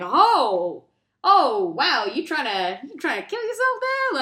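loud drawn-out oh, then high questioning; mock surprise. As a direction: play a passer-by stumbling on a scene. Acting the man's surprised discovery.

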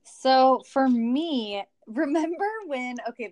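normal talking voice

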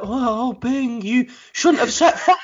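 a hint of a cartoon guardian, drawn out; lightly imitated Flop. Cartoon guardian gently telling off Bing, lightly imitated. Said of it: "Flop's just like".

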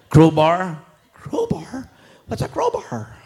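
sly pretend-naive whisper; mock innocence. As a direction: pretend you've never heard the word. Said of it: "playing innocent about crowbar".

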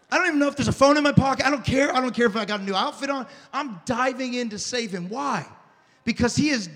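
ordinary talk, nothing marked

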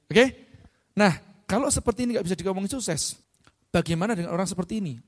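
ordinary delivery

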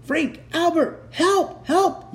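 very high acted yells; high, urgent storybook yell; yelling for help. A narrator's reading. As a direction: shout names and pleas in alarm. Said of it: "yelled Elmer again".

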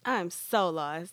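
drawn-out slow bewilderment; slow puzzled mutter; slow and confused. Real, not acted. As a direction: say it slowly, lost. First confused take.

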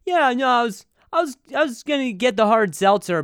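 high, loud hallway chatter; passerby's voice. Retold or imitated. Passerby chats down the hotel hall, put-on loud voice. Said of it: "I hear people come down the".

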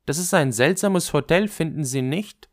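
put-on chatty girl. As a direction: lift the voice into a young woman's question. (leicht angedeutetes Mädchen, höhere Stimme)

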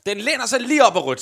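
animated touchline comment; trainer's agitated remark. Acted. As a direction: push it out loud as the trainer. Game master acts trainer's remark.

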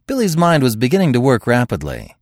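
ordinary delivery

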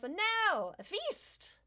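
high, showy invitation to the feast; grand host king. Acted. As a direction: sweep everyone to the table. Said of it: "And he smiles".

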